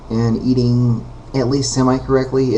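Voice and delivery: usual speaking voice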